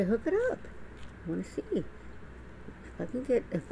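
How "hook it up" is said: "hook it up" jumped up high on "it", rising; excited high-pitched "hook it up"; squealed hook it up. Retold or imitated. Repeats her eager catchphrase.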